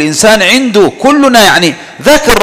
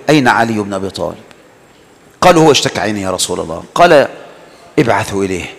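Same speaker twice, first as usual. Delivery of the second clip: much lower and softer quoted dialogue; hushed retelling; 10 semitones lower, 5 dB quieter, faster than usual. Retold or imitated. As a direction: drop the voice for the story's dialogue. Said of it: "فإذا بالنبي يقول". (رواية الحوار بصوت خافت ومنخفض)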